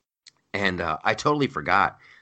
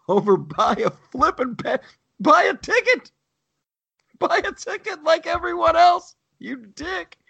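exasperated rant. Real, not acted.

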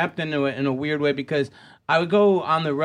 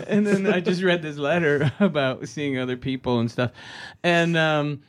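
usual speaking voice

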